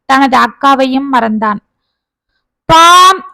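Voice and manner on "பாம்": loud, sustained honk by voice; long, high vocal honk; bus horn imitation. A narrator's reading. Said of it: "என்ற bus horn சத்தம்".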